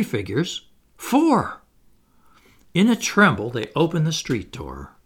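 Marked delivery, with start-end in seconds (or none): 1.0-1.6 sudden high-pitched gasp of a word; shocked reveal. A narrator's reading. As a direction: land it as a startling surprise. Narrator reveals fourth figure.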